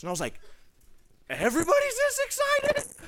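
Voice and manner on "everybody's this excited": very high, loud astonished question; incredulous squeak. Retold or imitated. [name] shocked that everyone feels as excited, squeaking. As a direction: squeak in disbelief. Naive disbelief.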